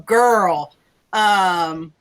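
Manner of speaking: ordinary delivery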